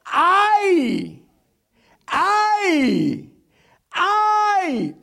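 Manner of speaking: high, drawn-out cries of woe; angel's great cry. Acted. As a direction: cry each "ay" high and long, as an angel's warning. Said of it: "diciendo a gran voz".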